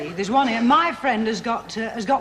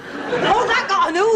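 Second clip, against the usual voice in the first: triumphant; 8 semitones higher, 4 dB louder, slower than usual. Real, not acted. Crowing over winning the bet.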